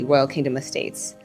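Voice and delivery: usual speaking voice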